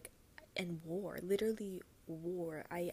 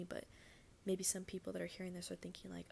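ordinary talk

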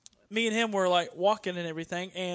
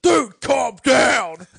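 very loud, slow, drawn-out shout; imitating an exasperated star's yell; shouted rebuke; 4 semitones higher, 14 dB louder, much slower than usual. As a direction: bellow it at an overexcited fan. Wrestler rebuking a screaming fan.

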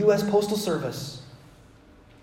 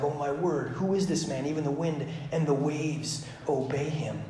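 soft acted wonder; hushed awe; 3 semitones lower, 10 dB quieter than usual. Disciples after he calms the storm, softly awestruck. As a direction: drop to a quiet, amazed voice.

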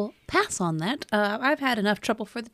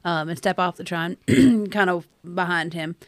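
ordinary delivery

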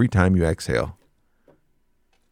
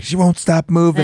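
higher, exasperated mock complaint; 10 semitones higher than usual. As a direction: play up the overwhelmed wife. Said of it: "she's like".

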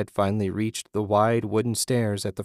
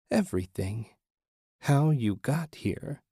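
a hint of an eerie female apparition; 4 semitones higher, 3 dB quieter than usual. A narrator's reading. Disembodied female voice answers.

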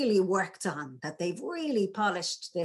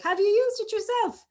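pitched way up and loud; high squeaky take on the question; 13 semitones higher, 6 dB louder than usual. Shown as a demonstration. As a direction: throw the voice right up high. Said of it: "you've obviously got pitch".

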